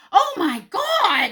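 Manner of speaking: very high, loud, drawn-out outcry; long squealed exclamation; horrified shriek. Retold or imitated. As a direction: mimic a daughter's horrified yell. Daughter's retold shriek at bug.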